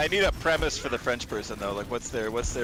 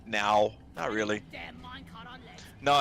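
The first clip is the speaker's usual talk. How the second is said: normal talking voice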